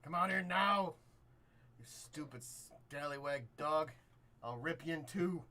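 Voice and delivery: loud, high threatening bellow; angry yelling. Acted. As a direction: yell threats as a loudmouthed brawler. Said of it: "he's being very loud, yelling for".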